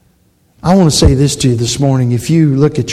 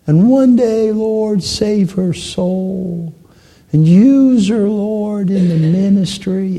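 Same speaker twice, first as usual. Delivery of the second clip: put-on high, long-drawn prayer of an old evangelist; John Jackson praying; 7 semitones higher, much slower than usual. Old evangelist praying over a little girl, high drawn-out plea. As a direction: wail the words slowly up to heaven. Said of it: "he just prayed like".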